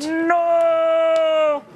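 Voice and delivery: crowd-leading shout. Real, not acted. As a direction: belt No! like a crowd chant. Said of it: "With me, everybody".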